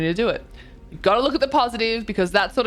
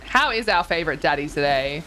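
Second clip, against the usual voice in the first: ordinary delivery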